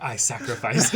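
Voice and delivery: laughing mock vow. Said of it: "Tsunohara's like".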